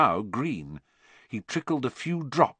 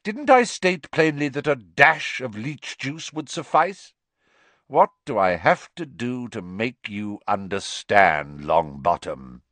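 cold, clipped teacher scolding; sneering Snape; 3 semitones higher, 4 dB louder than usual. Acted. Snape scolding Neville over his ruined potion, icy sneer.

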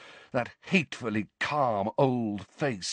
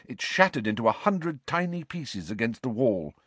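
ordinary delivery, nothing marked

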